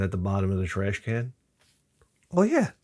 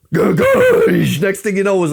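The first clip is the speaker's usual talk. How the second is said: loud, drawn-out and higher, like a blast; big slow shout; 7 semitones higher, 8 dB louder, slower than usual. Acted. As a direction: boom it out slowly. Caps exploding build-up.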